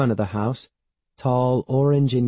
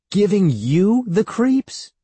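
high, loud, drawn-out disbelief; indignant cry; 11 semitones higher, 5 dB louder, slower than usual. A narrator's reading. Evan throwing Andy's complaint back at her, indignant cry. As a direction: stretch it slowly into an outraged high cry. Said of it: "Evan cried".

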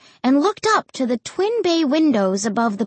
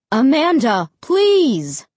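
loud plea rising high; exasperated plea; 5 semitones higher, 5 dB louder, slower than usual. A narrator's reading. Mom covering her ears at the shouting, exasperated plea. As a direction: beg her to stop, loudly.

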